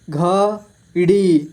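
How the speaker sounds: घड़ी split slowly into घ, ड़ी; syllable by syllable. Shown as a demonstration. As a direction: stretch and separate घ and ड़ी in घड़ी. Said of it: "इस तरह से आपको". (घड़ी को तोड़कर धीरे: घ, ड़ी)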